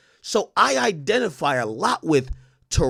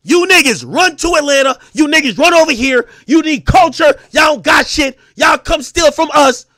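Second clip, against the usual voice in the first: mock diss shout; 10 semitones higher, 11 dB louder than usual. Voicing the diss track's lines.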